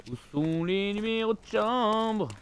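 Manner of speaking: mock puzzled drawl; slow drawn-out question. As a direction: stretch every word of "chambre" slowly. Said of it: "repeats the question".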